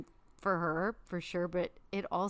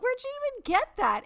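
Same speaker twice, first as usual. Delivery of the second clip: very high, rushed squeal; high-pitched retold outburst; mock screaming disbelief; 7 dB louder, much faster than usual. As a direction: squeal the question fast, as if retelling a shriek. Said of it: "I was, like, screaming".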